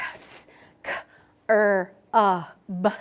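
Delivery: sounds said one at a time; sounds segmented. Shown as a demonstration. Teacher models segmenting for the class. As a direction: break it into separate sounds. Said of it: "if Miss Cook says".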